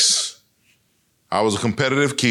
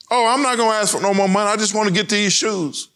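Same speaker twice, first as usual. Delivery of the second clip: put-on high, fast, eager little boy pleading to his mom; a boy promising his mom he won't ask for more money, high and fast; eager kid promise; 9 semitones higher, faster than usual. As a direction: rush it out like a kid desperate for new shoes.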